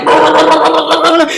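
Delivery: high wild cackle; Tourette's outburst. Retold or imitated. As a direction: burst into a wild high laugh. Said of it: "he just starts going like".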